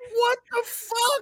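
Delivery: high cracking yell of disbelief; incredulous yell. Real, not acted. Outraged at the hazing, a high incredulous yell.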